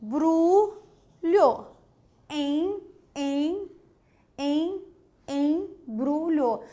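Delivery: embrulho with the em held and nasal, syllable by syllable; nasal vowel demo. Shown as a demonstration. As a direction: stretch the em of embrulho through the nose. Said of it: "Se você diz lá".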